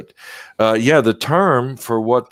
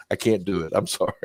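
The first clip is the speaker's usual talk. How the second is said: ordinary talk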